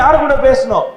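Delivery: ordinary talk, nothing marked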